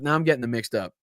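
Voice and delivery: ordinary talk, nothing marked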